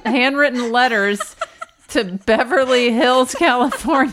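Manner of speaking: laughing through the words; giggling while saying it. Real, not acted. Mocking flood of angry letters.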